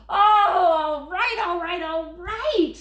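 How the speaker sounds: loud, high, exasperated; frantic giving in. Acted. Grover gives in to the child, loud and exasperated. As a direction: throw up your hands and give in loudly.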